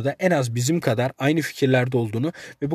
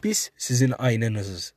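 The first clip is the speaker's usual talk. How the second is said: normal talking voice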